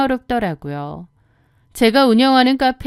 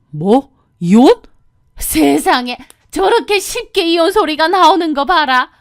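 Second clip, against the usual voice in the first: loud, rising shocked mother-in-law; shocked disbelief; 6 semitones higher, 5 dB louder than usual. Acted. Mother-in-law shocked by divorce talk, appalled. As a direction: gasp in disbelief.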